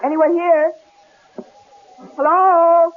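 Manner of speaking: wife calls into the empty lodge; calling out. Acted. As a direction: call for the owner. Calling into cabin.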